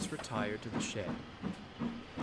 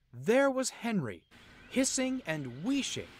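much higher and louder narration; dramatic reveal; 10 semitones higher, 7 dB louder than usual. A narrator's reading. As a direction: announce the shocking sight.

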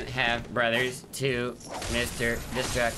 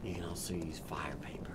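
whispering; 6 semitones lower, 9 dB quieter than usual. Real, not acted. Quiet game advice.

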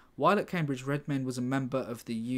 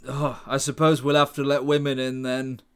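mock posh clubman; 9 dB louder than usual. Mocking the club's male members.

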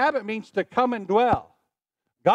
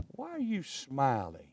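soft, slow, baffled question; puzzled annoyance; 7 semitones lower, 11 dB quieter, slower than usual. Retold or imitated. A preacher voicing someone chewing out a smiler, soft and slow. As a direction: ask it low and slow, mystified. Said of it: "They'll go".

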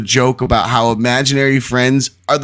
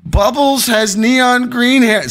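much higher, nervous prank caller; panicked caller voice; 12 semitones higher, much slower than usual. Acted. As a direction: play a frantic caller. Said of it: "I'm supposed to be in a panicked voice".

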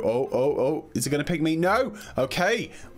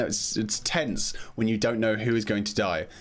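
normal talking voice

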